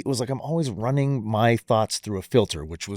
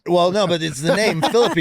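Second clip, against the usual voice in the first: usual speaking voice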